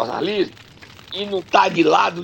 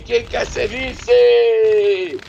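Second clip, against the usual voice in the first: auctioneer call; 4 semitones higher, 5 dB louder, much slower than usual. Pretend. Auctioning off the vice slot.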